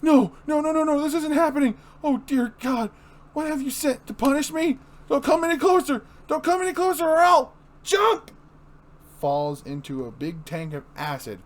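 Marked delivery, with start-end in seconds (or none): 0.0-8.3 high, loud desperate pleading. Acted. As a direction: back away screaming in fear.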